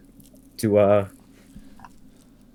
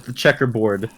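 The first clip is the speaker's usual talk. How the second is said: ordinary talk, nothing marked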